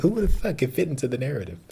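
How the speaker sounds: a lightly put-on, quick, high voice of disbelief; incredulous viewer voice. Reviewer mimicking a stunned viewer, quick high mock disbelief. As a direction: toss it off fast like an amazed viewer. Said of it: "You're like".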